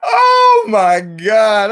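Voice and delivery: high, slow, wheezing oh my God; drawn out through laughter; laughing disbelief. Real, not acted. Vlogger wheezing at the bizarre footage, laughing.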